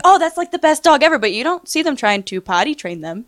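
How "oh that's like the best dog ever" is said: higher, louder gushing over the dog; mock gushing. Imitating a dog owner posting online, mock gushing. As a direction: gush like a proud new owner.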